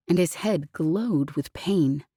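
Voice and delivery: plain reading voice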